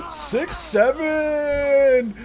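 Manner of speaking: six seven wailed high, loud and very slow; loud drawn-out six seven. Retold or imitated. Woman in the lab pushing the joke a third time, loud and stretched. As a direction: belt it out long, half crying the words.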